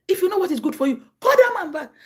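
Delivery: rising into a loud stern command; stern warning. Retold or imitated. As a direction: order your mother to call him back.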